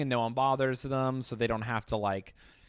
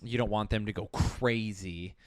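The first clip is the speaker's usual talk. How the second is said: ordinary talk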